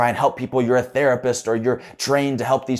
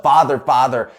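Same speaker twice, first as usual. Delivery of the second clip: calling out; 5 semitones higher, 8 dB louder than usual. Retold or imitated. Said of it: "the guy shouts up".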